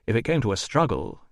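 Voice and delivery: plain reading voice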